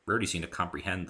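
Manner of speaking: ordinary delivery, nothing marked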